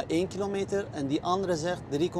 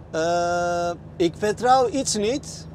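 ordinary talk

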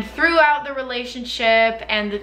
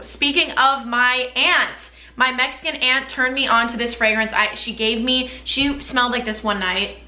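normal talking voice

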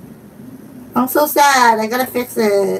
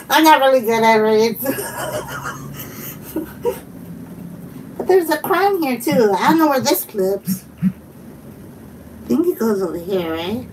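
ordinary talk

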